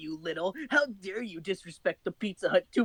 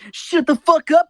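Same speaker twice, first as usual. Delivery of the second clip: mock yell; 9 semitones higher, 11 dB louder than usual. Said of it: "Sonic immediately retorted".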